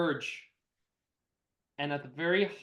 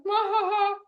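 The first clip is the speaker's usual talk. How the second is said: high villain cackle; high stage-villain laugh; evil cackle; 14 semitones higher than usual. Acted. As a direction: cackle triumphantly. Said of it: "prime villain character".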